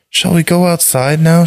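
put-on high-pitched girl's question; little girl's voice. A narrator's reading. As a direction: ask it high, as a young girl. Narrator voices the girl Maria.

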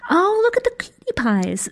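put-on high cooing little girl. A narrator's reading. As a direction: coo like a small child. Four-year-old girl speaks.

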